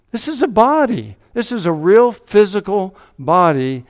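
high, loud, rising to a pulpit climax; preaching at full force. Acted. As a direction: drive the proof home to the congregation.

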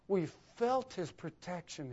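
ordinary talk, nothing marked

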